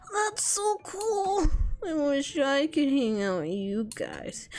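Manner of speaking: high wistful gush, drawn out; needy, awkward high character voice; over-eager lonely troll. Acted. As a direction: start high and thrilled, sink into loneliness. Plays Zebedee, eager then lonely.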